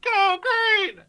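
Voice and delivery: loud, slow bullhorn chant; through a megaphone. Acted. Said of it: "really good at making that sound like a megaphone".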